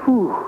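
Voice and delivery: a shaken whew after the reading; whew of dismay. Real, not acted. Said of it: "Makes me go".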